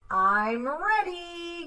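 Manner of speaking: eager cry. A narrator's reading. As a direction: call out proudly. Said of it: "cried Froggy".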